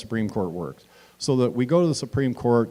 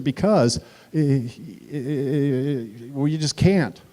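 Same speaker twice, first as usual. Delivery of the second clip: stammering reporter; 3 semitones higher, slower than usual. Retold or imitated. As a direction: hem and haw like someone out of answers. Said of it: "He said".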